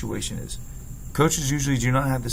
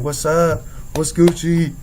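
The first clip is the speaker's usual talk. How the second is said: louder, higher, pumped-up hello; hyped greeting; 5 semitones higher, 6 dB louder, much slower than usual. Retold or imitated. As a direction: throw energy at the room. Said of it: "I threw out some excitement".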